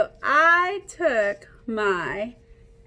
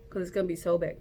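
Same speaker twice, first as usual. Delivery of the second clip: gasp, then rush it out in awe; breathless wide-eyed wonder; amazed gasp; 5 semitones lower, much faster than usual. Shown as a demonstration. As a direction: gasp and gush at how big. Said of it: "you're gonna go like this".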